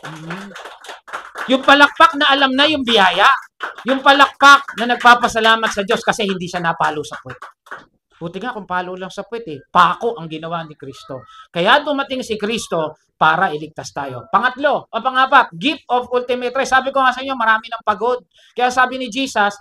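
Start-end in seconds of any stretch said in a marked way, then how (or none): 1.5-7.4 loud, rousing call for applause; rally the congregation loudly; forceful preaching. Real, not acted. Preacher calling the church to applaud the Lord, forcefully. 11.5-19.6 loud, driving announcement; forceful preaching. Real, not acted. Preacher announcing the next gift to the church, loudly.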